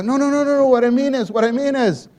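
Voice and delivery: high, loud imitated pleading; mother imitated, high and loud; insistent mother. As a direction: press the real question. Mother pressing further.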